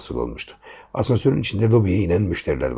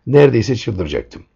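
ordinary delivery, nothing marked

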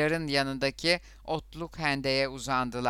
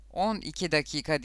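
ordinary delivery

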